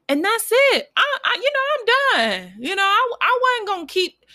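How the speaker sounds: excited, flustered sign-off; giddy excitement. Real, not acted. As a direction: bubble over trying to wrap up. Giddy rambling wrap-up.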